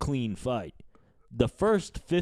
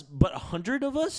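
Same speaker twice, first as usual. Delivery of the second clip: incredulous; 8 semitones higher, slower than usual. Real, not acted. Arguing gorilla fight.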